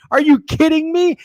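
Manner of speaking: high, loud disbelief; are you kidding me, way up high; incredulous. Real, not acted. Streamer to chat, high loud disbelief.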